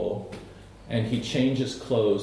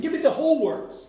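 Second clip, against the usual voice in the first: eager Peter; 13 semitones higher, 4 dB louder than usual. Retold or imitated. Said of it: "That's what he basically said".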